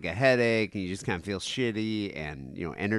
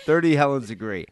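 talking through laughter; amused, words riding on a laugh; 5 semitones higher, 4 dB louder than usual. Real, not acted. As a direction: laugh your way through the line. Laughing at the shouted joke.

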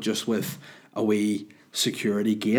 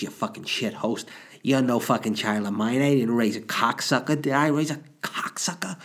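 raised, ranting Italian mum; Italian mother voice; 3 semitones higher than usual. Retold or imitated. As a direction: scold like an outraged mother. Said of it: "That's, that's my mum".

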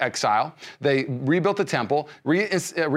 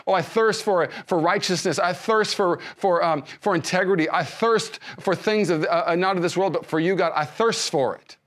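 earnest fervent prayer; 6 semitones higher than usual. Shown as a demonstration. Preacher praying aloud for the church, fervent and pleading. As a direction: pray it like you mean it, leaning into each thirst. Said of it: "if you need to pray it, pray it".

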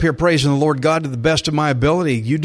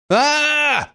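high sudden cry out; loud cry; 11 semitones higher than usual. Shown as a demonstration. Preacher demonstrating the Israelites crying out, a high loud cry. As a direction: cry out to God. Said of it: "They cried out loud".

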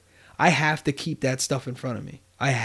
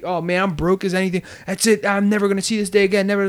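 riled-up, fast, higher complaint; frustrated venting; 5 semitones higher, faster than usual. Retold or imitated. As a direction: vent like you're fed up and broke. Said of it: "You could be frustrated right now and all hype".

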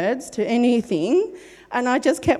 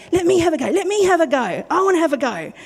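eager pleading; 6 semitones higher, faster than usual. Retold or imitated. Eager friends wanting their turn.

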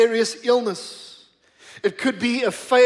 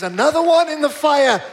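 raised, higher-pitched preacher's proclamation; ringing pulpit declaration, lifted in pitch; bold declaration; 5 semitones higher, 5 dB louder than usual. Real, not acted. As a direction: proclaim it boldly to the congregation. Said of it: "I want to declare to you boldly".

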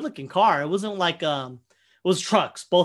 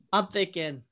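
normal talking voice

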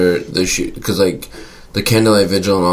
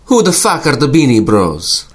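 put-on Italian accent; 5 semitones higher than usual. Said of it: "I had to do an Italian accent".

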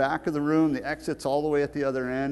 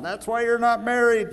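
high, louder jokey heckle from the pews; mock heckler yell; 11 semitones higher, 5 dB louder than usual. Old church member heckling the preacher, mock yell. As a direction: shout it like a cheeky churchgoer. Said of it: "this would be the time they start yelling".

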